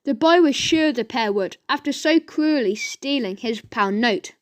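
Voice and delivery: ordinary delivery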